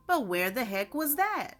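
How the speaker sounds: plain reading voice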